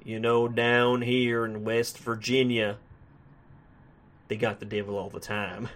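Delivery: slow, drawled Southern storyteller; Southern narrator impression. Reviewer imitating the film's narrator for listeners, Southern drawl. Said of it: "waiting for the narrator at some point just to say".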